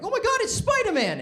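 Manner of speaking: put-on high, loud Italian-accented cry; an Italian bystander shrieking in delight; excited Italian onlooker. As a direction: shout up at the falling man like a thrilled tourist. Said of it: "some Italian guy being like".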